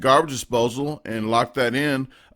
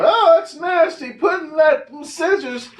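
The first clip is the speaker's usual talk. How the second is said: "you people" voice; 13 semitones higher than usual. Acted. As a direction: whine like an annoying, disgusted commenter. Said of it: "That's just my you people, you people voice".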